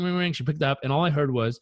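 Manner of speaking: usual speaking voice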